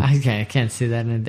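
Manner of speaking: ordinary delivery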